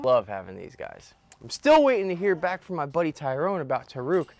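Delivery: normal talking voice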